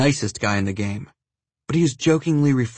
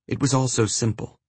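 plain reading voice

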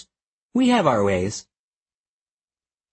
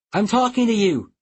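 calling out; 8 semitones higher than usual. Acted. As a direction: demand his attention, calling. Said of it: "I called".

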